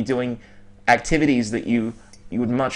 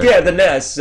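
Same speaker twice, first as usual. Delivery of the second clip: loud, high hammed-up Italian accent on togetherness; bad Italian accent; 5 semitones higher, 8 dB louder than usual. Podcast host jokingly tries an Italian word, bad Italian accent. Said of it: "that's my really bad, uh, Italian accent".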